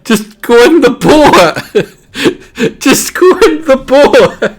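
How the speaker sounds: high, breaking up laughing. Real, not acted. Streamer cracking up at the frog, laughing hard. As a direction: try to say it through helpless laughter. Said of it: "it's so stupid".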